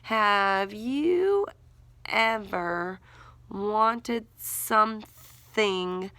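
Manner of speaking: drawn-out word-by-word writing pace; slow dictation. Shown as a demonstration. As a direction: say each word slowly while writing. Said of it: "teacher writes while saying words".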